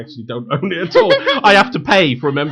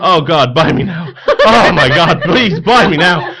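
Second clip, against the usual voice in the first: louder, higher, hammed-up begging; mock desperate pleading; 4 semitones higher, 8 dB louder, slower than usual. Joking host playing an eBay item, begging buyers loudly. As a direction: beg a buyer to click, half laughing.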